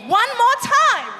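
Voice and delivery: hyped-up urging. Real, not acted. Urging crowd to cheer again.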